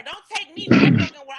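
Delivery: loud, blunt demand. Retold or imitated. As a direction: lay down the law, loud. Voicing what she should say.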